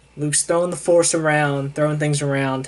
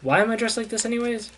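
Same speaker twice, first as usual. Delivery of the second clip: higher, faster mock complaint; indignant Sarah; 8 semitones higher, faster than usual. Sarah complaining about her disguise, higher mock indignant. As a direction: rattle it off, put out. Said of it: "And she goes".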